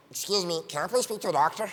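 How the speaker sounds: squeaky helium-accident voice. Acted. Prankster to the admitting nurse, polite helium squeak. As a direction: ask politely on a lungful of helium. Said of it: "with the helium voice".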